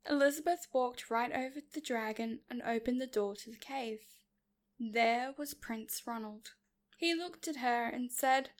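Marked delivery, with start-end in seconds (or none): none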